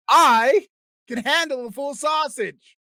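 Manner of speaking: high, loud and slow, proudly declared; shouting his big claim to the audience; boastful shout. Real, not acted. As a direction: bellow it like a bold boast. Boastful claim about handling sausage.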